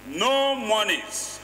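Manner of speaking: slow, weighty No before monies; stress on NO. Shown as a demonstration. Lawmaker reading subsection three, leaning on No. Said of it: "emphasis again on no".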